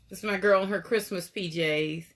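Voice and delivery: ordinary talk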